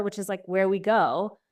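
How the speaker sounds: ordinary talk, nothing marked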